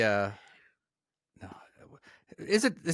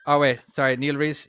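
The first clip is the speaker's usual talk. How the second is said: usual speaking voice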